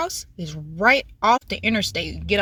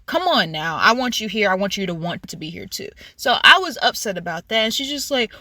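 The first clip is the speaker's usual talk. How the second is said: usual speaking voice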